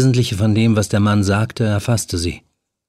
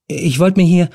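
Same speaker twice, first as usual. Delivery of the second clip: lightly raised nervous woman's voice; a young woman caught trespassing, higher; Helen, nervous; 8 semitones higher, 3 dB louder than usual. A narrator's reading. Helen caught in the flat, talking to the stranger, higher and nervous.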